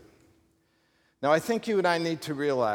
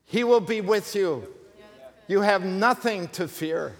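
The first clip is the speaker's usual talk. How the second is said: preaching at full force; 4 semitones higher than usual. Acted. Preacher to congregation about God's presence, fervent and rising. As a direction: lift the congregation with rising fervour.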